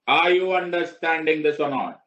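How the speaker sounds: slow and loud, each word stretched; loud drawn-out check. Shown as a demonstration. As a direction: demand the class answer, drawn out. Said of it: "teacher checking class". (धीमे और ज़ोर से खींचकर पूछना)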